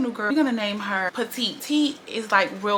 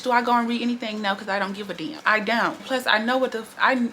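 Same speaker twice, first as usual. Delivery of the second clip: ordinary talk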